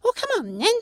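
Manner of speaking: tiny creature asking in wonder; pixie voice. Acted. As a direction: pixie asking the stranger if rain melts her. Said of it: "the pixie said to Mo".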